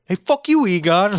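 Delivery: very high, mock New England accent; New Hampshire accent. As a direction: imitate a rude New Hampshire local. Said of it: "It's New Hampshire, I guess".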